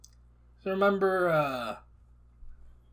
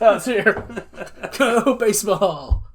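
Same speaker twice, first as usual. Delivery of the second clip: laughing, louder and higher; 6 semitones higher, 9 dB louder, slower than usual. Real, not acted. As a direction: cheer through laughter. Laughing while finding the bat.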